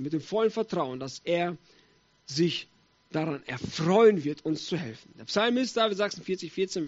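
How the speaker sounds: ordinary talk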